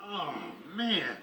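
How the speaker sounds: ordinary talk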